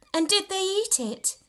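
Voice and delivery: put-on high, eager child's question; little girl asking. A narrator's reading. Little sister interrupts the tale, high and eager. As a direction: ask it like a wide-eyed small girl.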